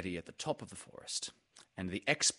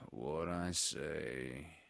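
low, slow, soft gloomy donkey; Eeyore; 4 semitones lower, 5 dB quieter, much slower than usual. Acted. Eeyore objects to the expedition, gloomy. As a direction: drag it out, weary and low.

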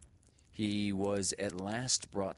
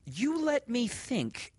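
high, slow scolding voice; chiding teacher; 6 dB louder, slower than usual. A narrator's reading. Teacher chiding the boy, high and slow. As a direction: scold the boy gently but firmly. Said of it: "she chided with as much severity".

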